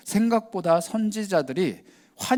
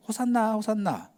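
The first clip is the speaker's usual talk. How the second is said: put-on higher, light children's chant; childlike hosanna; 6 semitones higher than usual. Children following Jesus in the temple, high childlike chant. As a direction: sing it out like little kids.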